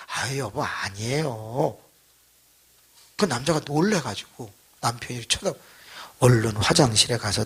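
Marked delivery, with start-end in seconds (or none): 0.1-1.8 soft, low, drawn-out denial; gentle reassurance. Retold or imitated. Wife reassuring her worried husband, soft and slow. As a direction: imitate a newly meek wife soothing him.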